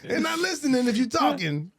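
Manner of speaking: high-pitched mock exasperation; mock complaint. Jokingly acts out not being heard.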